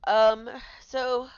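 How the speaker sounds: ordinary delivery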